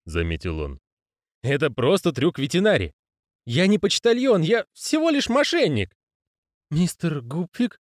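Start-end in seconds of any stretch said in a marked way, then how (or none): 1.4-6.0 agitated protest. Acted. Moist protests, higher voice. 6.7-7.8 nervous. A narrator's reading. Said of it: "нервный голос".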